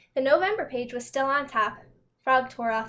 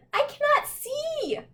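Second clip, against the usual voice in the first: much higher, slow, dazzled wail; squeaky panicked Toad; slower than usual. Acted. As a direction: squeal in comic distress.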